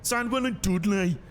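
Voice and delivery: slow, high, hammed-up Brummie; over-exaggerated Brummie accent. Shown as a demonstration. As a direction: do a deliberately bad, drawn-out Brummie accent. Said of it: "like, an over-exaggerated, uh, Brummie accent".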